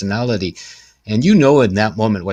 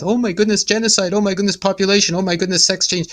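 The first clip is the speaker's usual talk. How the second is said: much higher, mock-panicked gasping; mock alarm; 10 semitones higher than usual. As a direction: parody the hand-wringing public. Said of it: "all this stuff".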